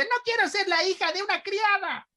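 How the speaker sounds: put-on very high squeaky girl voice; Chilindrina voice. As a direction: imitate a whiny little girl. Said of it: "Tipo la Chilindrina haciendo a una niña".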